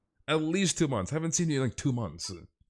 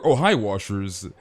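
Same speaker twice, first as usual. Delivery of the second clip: ordinary talk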